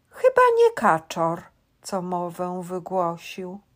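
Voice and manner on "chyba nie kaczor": sudden high squeal; high squeak. Acted. Sudden high loud line.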